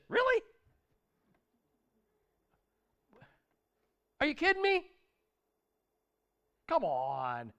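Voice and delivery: disbelieving, almost mocking; incredulous Jesus. Jesus scolding his doubting disciples, incredulous and exasperated. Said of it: "I wonder if Jesus said".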